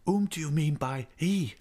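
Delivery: high, tense woman's question; changed voice. A narrator's reading. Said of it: "she asked in a changed voice".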